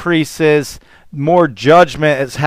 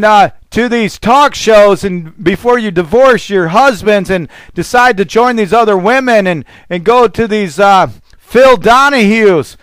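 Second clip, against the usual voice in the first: raised, heated pulpit preaching pitched higher; preaching at full force; 4 semitones higher than usual. Acted. Preacher rails at wives over talk shows, full-force preaching. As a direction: drive it home to the congregation.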